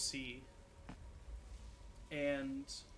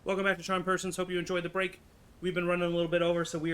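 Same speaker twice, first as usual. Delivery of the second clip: upbeat, louder show-host voice; energetic stream host announcing the return; host welcome back; 4 semitones higher, 7 dB louder, much faster than usual. Acted. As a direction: bring everyone back in like a livestream host.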